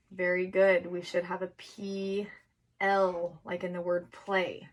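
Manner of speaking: ordinary talk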